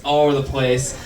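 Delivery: ordinary talk, nothing marked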